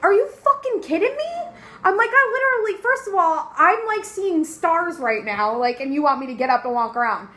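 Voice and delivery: very high, loud incredulous yell; you've got to be kidding; patient yelling back at the nurse, shocked; outraged disbelief. Retold or imitated. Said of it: "I was like".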